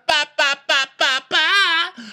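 very high, monotone nonsense syllables; baby-fight babble. Retold or imitated. Mocking a rival like a fighting baby, high babble. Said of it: "it just becomes baby fighting".

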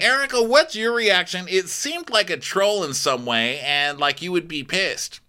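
high, eager interviewer voice; exaggerated host impression; Andy. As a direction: pitch the question up like a prying host. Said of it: "So Andy's like".

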